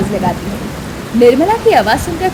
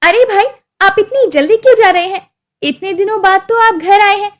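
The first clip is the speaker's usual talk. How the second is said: raised, coaxing voice; sweetly insistent sister; 7 semitones higher than usual. Acted. Sister urging her brother to stay, coaxing. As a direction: urge him sweetly to stay.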